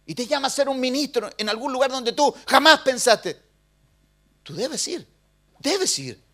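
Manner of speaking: loud, high, pleading sermon delivery; preaching at full force. Acted. Pastor urges the congregation to finish their course, loud preaching.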